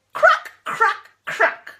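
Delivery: high loud crack, crack, then a voiceless crack; cracking egg. Acted. Reading the egg hatching to a child, bright cracks. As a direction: pop each crack like a shell breaking.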